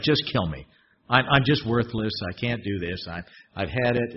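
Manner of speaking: low, defeated Moses; worn-out despair. Acted. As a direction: let it sink into defeat. Moses voiced giving up.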